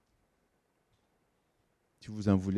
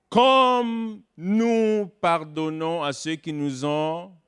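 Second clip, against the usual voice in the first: Comme drawn out long, higher and louder, whole line very slow; stress on comme; 6 semitones higher, 4 dB louder, much slower than usual. Shown as a demonstration. Preacher stressing Comme in the prayer for the congregation, very slow. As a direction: lean hard on the word Comme and stretch the line. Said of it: "le mot «comme»".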